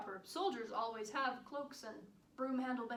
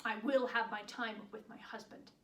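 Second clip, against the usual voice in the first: firm insistence; 3 dB louder than usual. Acted. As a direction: insist on your right, holding back the shout. First insistence on time with husband.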